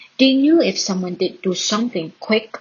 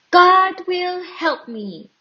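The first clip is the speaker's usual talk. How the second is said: calm faith; 9 semitones higher, slower than usual. A narrator's reading. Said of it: "David said".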